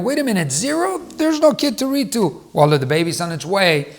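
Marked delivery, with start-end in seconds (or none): 0.0-2.5 higher, puzzled objection from the viewer; a doubting parent pushes back, a little higher; mock viewer surprise. Viewer questioning the teacher, higher mock surprise.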